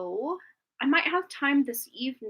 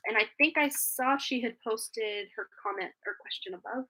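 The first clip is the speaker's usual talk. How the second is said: ordinary talk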